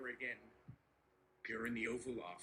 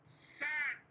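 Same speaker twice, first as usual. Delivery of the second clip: higher, louder one-word jab; mock outburst; 12 semitones higher, 7 dB louder than usual. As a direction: spit out the verdict. Trump's signature exclamation.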